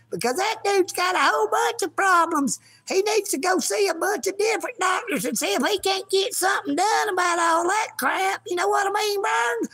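high, whiny cat voice with a tearful edge; talking kitty voice. Pretend. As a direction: voice the offended kitty still loving its owner. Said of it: "don't be using my lines there, kitty".

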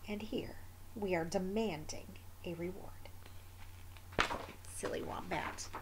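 soft unvoiced whisper; whispered aside showing the wombat picture. Real, not acted. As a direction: whisper fondly to the listener.

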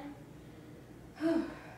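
tired, breathy sigh after the set; heavy exhale. Real, not acted. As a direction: blow out air after effort. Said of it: "now catch your breath again".

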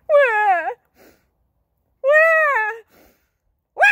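high loud mock baby cries; baby wail. Baby Jacob crying in the storm, loud high wails.

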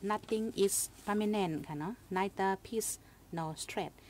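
quoting the English proverb slowly, word by word; slow English saying. Retold or imitated. Host quoting an English saying to listeners, slow and deliberate. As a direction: let each English word land, slowly. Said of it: "ตั้งอังกฤษได้ก็เปิ้นว่า".

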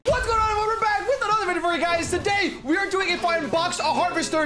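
high, rapid-fire host greeting; hyped video intro. Real, not acted. Host welcoming viewers to another video, very hyped. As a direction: launch the show at a high pitch.

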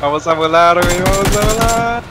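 más agudo y entusiasta; animándose a saltar ya; emocionado. Real, not acted. Repite emocionado antes de saltar. (voz emocionada que sube)

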